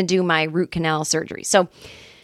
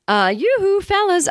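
mock flirty call; 12 semitones higher than usual. Calling out jokingly.

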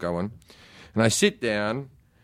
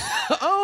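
sudden high laugh; laugh, caught off guard; high burst of laughter. Real, not acted. Laughs at Scorsese reveal.

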